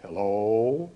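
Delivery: a low, flat "Hello?" answering the phone; low caller voice. Shown as a demonstration. Person answering the phone, low flat hello. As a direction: pick up the phone sounding subdued. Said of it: "they answer the phone".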